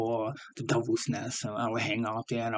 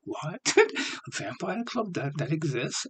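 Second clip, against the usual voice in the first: incredulous surprise; 5 semitones higher, 3 dB quieter than usual. Retold or imitated. Hearing about a vampire club.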